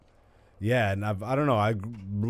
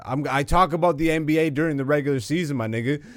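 ordinary delivery

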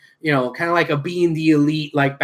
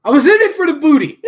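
high, loud, fast blurt; loud confession; 10 semitones higher, 8 dB louder, much faster than usual. Real, not acted. Co-host teasing him about the movie, loud high confession. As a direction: blurt it out emphatically, high and quick. Mock-defensive confession after being teased.

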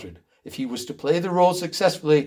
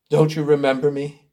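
ordinary delivery

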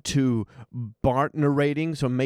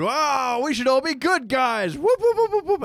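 mock cheering; 12 semitones higher than usual. Mocking the characters' sudden goodness.

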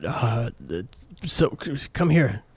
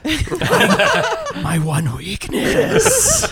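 laughing; 4 dB louder, much slower than usual. Real, not acted. Laughing mock lament.

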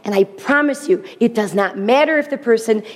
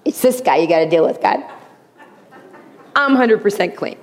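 smug self-righteous. Pretend. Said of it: "you may wanna think".

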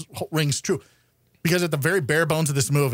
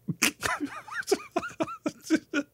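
high laugh; 7 dB quieter than usual. Real, not acted. Cracking up at own joke.

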